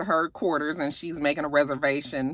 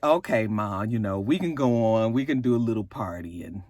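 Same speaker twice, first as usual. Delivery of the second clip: low, grudging son giving in; lightly voiced son; 7 semitones lower than usual. Recapper voices A J giving in to his mother, low and grudging. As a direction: mumble a reluctant yes, lower. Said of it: "he was like".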